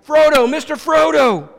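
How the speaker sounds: high, loud shout. A narrator's reading. Hobbit crashing onto the shore after his friend, shouting. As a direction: call out loudly after a departing friend.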